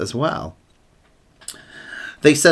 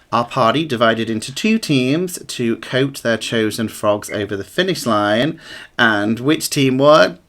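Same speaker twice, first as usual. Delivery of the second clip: ordinary delivery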